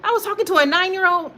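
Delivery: high, startled mock outrage; squeaky imitated shock at herself; shocked disbelief. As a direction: act stunned at the discovery. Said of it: "I'm like".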